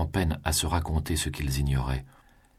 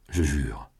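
a cool, restrained oath; coldly; 2 semitones lower than usual. A narrator's reading. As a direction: swear it with no warmth at all. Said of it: "reprit-elle froidement". (froidement, sans chaleur)

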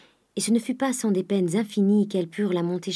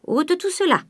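high, loud command; sharply raised, bossy voice; curt order; 11 semitones higher, 5 dB louder than usual. A narrator's reading. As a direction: order the servant to clear it away. Grandet orders the servant about.

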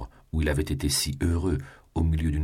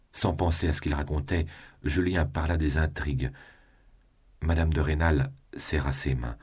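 plain reading voice

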